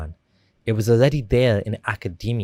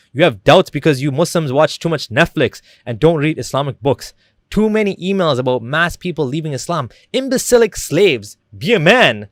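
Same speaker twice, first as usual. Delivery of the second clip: louder, higher imitation of an angry rant; mock screaming rant; 5 semitones higher, 7 dB louder than usual. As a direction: rant it out, mimicking someone yelling. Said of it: "he was screaming and ranting things like".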